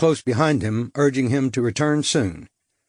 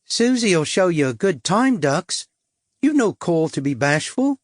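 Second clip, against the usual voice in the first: higher, coy cockney come-on; cockney prostitute; 5 semitones higher than usual. A narrator's reading. Suzette luring the stranger, coy cockney come-on. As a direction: tease him in, sweet and brassy. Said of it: "She minced across the hallway at me".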